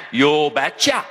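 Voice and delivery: put-on low Norwegian father's accent; clipped-down Norwegian "you betcha"; thick Norwegian accent. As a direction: imitate a Norwegian dad, low. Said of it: "in his thick Norwegian accent".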